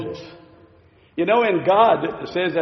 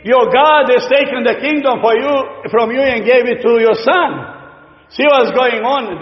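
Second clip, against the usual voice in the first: raised, louder taunting voice of the enemies; mocking enemies; 6 semitones higher, 5 dB louder than usual. Enemies taunting David about his God, raised jeering voice. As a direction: jeer at him that his God has left.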